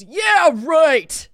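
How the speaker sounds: loud, high scoffing yeah right; sarcastic retort. Retold or imitated. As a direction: throw it back with contempt. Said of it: "And he goes".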